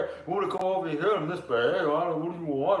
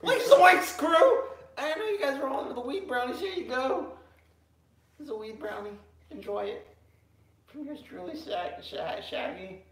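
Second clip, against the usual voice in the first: Shaggy impression; 6 semitones higher than usual.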